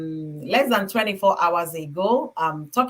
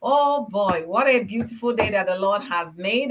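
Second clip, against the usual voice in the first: usual speaking voice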